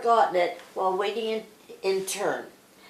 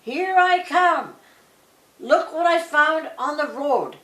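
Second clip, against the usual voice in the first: calling out; 8 semitones higher, 4 dB louder than usual. A narrator's reading. Claude calling to brothers.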